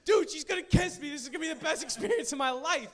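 high, loud, giddy shout to friends; freaking out. Retold or imitated. Boy calling all his friends about a coming kiss, acted freak-out. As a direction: gush it high and loud, speeding up. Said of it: "I'm, like, freaking out".

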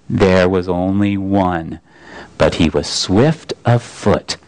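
a great calm voice weighing each word; Aslan's voice. Acted. The lion corrects Shasta, low and slow. The Voice speaks slowly.

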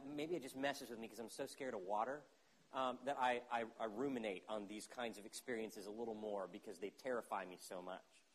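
ordinary talk

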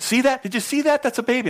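put-on high, eager doctor pointing out the baby; excited doctor. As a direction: lift it up bright and eager.

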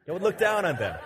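said through a laugh; laughing at his own pun. Real, not acted. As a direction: chuckle through the repeat. Joke on looking down literally.

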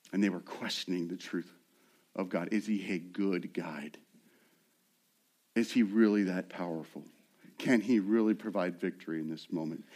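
low, soft, near-whispered questioning; doubting hush. Acted. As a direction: draw them in with hushed doubt. Preacher voices doubting Israelites softly.

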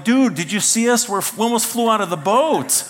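high, loud, incredulous complaint; worked-up "can you believe it" outburst; retold outraged protest. Acted protest after near accident.

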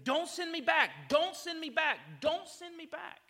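loud, high, desperate sobbing plea; pleading cry. Retold or imitated. Foster boy under the bed crying to his new dad, desperate plea. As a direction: beg through tears, voice cracking. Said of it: "He's like".

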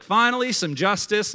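high-pitched exclamation of relief; acted-out "at last!" outburst; vindicated relief. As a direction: burst out as a satisfied onlooker. Acted audience relieved at justice.

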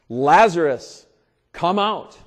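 loud commanding call, held long. Retold or imitated. Preacher calls out as Jesus at the tomb, loud commanding call. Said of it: "called out in a loud voice".

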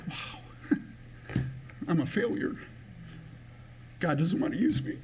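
softly choked up, starting on a near-silent wow; real emotion welling up as you recall feeling rejected; emotional. Real, not acted. Preacher recalling to the congregation his sense of failure, emotional. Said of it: "I don't know why I'm getting emotional".

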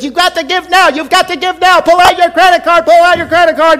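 high, loud hammed-up TV appeal; pushy telethon host. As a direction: push the viewer to pay now. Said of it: "get on the T V and says".

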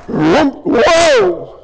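high, drawn-out mock whoa; awestruck exclamation. Preacher acting out disciples on the mountaintop, awestruck. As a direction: cry out like a stunned disciple.

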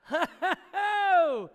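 loud, high, open laugh; burst of laughter. Real, not acted. Pastor laughs at his own salsa mix-up, loud burst of laughter.

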